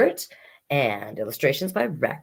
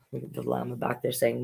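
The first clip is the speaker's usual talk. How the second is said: ordinary delivery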